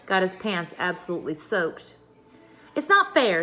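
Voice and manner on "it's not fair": loud, high stern protest. A narrator's reading. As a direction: put your foot down sternly. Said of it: "said Pippi sternly".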